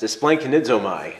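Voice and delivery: a playful quick run at esplanchnizomai; quick tongue-twister. Shown as a demonstration. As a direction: say esplanchnizomai briskly, about to laugh. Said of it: "say that five times fast".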